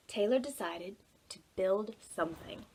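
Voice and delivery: normal talking voice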